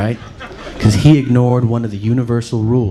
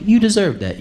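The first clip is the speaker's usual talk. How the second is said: gloating scorn; 7 semitones higher than usual. Retold or imitated. Acted gloating at attack victim.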